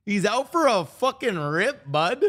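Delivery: high, loud mock Alberta twang; Alberta accent. Streamer imitates the accent they love, loud Alberta accent. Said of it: "I love Alberta accent".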